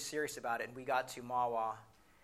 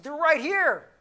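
very high, loud outburst; angry shout; 15 dB louder, slower than usual. Retold or imitated. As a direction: yell it in exasperated fury. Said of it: "I was so angry".

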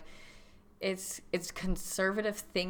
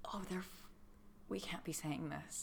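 hushed, breathy whisper; 3 semitones lower, 16 dB quieter than usual. Real, not acted. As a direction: whisper it so nobody hears. Realizing they shouldn't say names.

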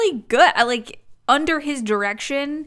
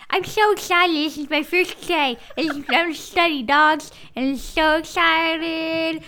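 eager student; 5 semitones higher than usual. Retold or imitated. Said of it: "Can you imagine being that student".

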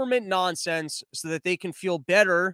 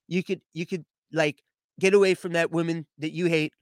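rambling mock-presidential boast in a put-on voice; comic ex-president impression, punctuated with okay; Donald Trump; 3 semitones lower than usual. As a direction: impersonate a bragging politician, riffing as you go. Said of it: "And then his first tweet".

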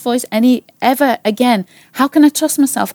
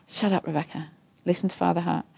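soft, quiet scolding to herself; hushed self-rebuke; 4 semitones lower, 11 dB quieter than usual. Retold or imitated. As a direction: tell yourself off under your breath.

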